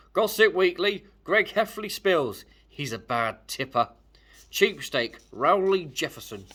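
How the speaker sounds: loud, slow headline voice; tabloid announcer. Acted. Gossip magazine announcer revealing Greg's secrets, loud and slow. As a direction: announce it like a gossip show host.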